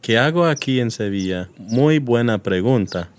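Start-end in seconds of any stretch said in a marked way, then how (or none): none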